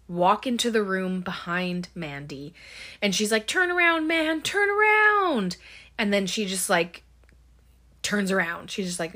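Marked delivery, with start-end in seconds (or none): 3.5-5.6 imitated urgent TV-watcher's yell; mock shouting. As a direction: act out yelling at the screen, stretching the last word. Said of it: "Mandy shouting at the T V".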